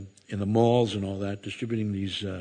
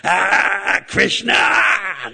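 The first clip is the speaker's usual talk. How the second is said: loud, much higher furious cry; angry yell; 10 semitones higher, 6 dB louder, much slower than usual. Retold or imitated. Wrestlers chasing the devotee to the van, loud high yell. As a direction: roar it after a fleeing man. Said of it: "three huge wrestlers behind me going".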